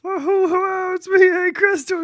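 high goofy showman voice; high, eager puppet-maker pitch; Hank Crestor voice. Acted. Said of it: "Is that what you think he sounds like?".

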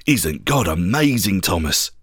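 deep, calm marvelling; Peter's awed assurance. Acted. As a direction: speak low and softly, full of quiet wonder. Peter's joyful words, low voice.

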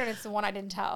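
normal talking voice

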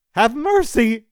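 high, loud plea for mercy; desperate shout. A narrator's reading. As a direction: shout it as if falling into the void. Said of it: "he shouted as he spiraled".

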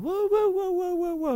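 high, whimpering woo-woo of a hurt puppy; imitated dog crying. Owner imitating his injured dog crying all night, high whimpers. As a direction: mimic the little dog crying. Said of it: "he's like crying".

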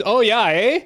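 high, loud Canadian "eh?" with a rising end; Canadian tourist tossing off a bouncy "eh"; Canadian accent. Retold or imitated. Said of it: "If it's a Canadian".

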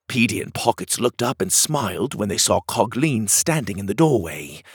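usual speaking voice

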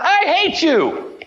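put-on boy yelling at his mom in an argument, high and slow; childish outburst. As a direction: stretch it slowly like a hurt seven-year-old. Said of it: "I screamed out".

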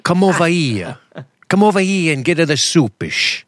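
imitated Portuguese immigrant English; wicked Portuguese accent. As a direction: beckon like a Portuguese grandmother, accent laid on thick. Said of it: "They had a wicked accent".

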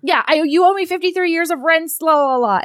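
high, loud excited bragging; giddy boast. Retold or imitated. He brags to her that he owns her house. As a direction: blurt it out, giddy and proud. He blurts out being the Duke.

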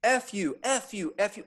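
high-pitched mock yell; retold screaming. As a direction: imitate someone hurling repeated curses, held back. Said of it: "Still screaming at me".

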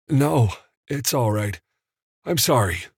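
groggy apology, only mildly croaked; slight croak. A narrator's reading. Evan just woken and apologising to Donna, faint croak. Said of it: "he managed to croak".

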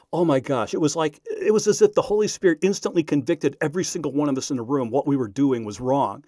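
usual speaking voice

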